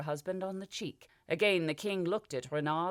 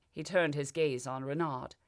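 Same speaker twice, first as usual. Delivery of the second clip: ordinary delivery, nothing marked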